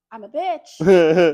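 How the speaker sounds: low, slow and loud, voice dropped; dropped-octave bitch. Retold or imitated. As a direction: drop into a heavy low growl of a voice. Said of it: "if I shift my tone in any way".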